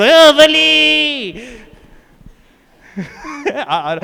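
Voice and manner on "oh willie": high, loud, long-drawn groan of fans; mock crowd outcry. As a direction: cry out the player's name slowly, like a whole exasperated crowd. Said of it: "and then everyone goes".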